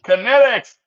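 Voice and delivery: loud, high call of the name; real call out. Real, not acted. Calling out friend's name.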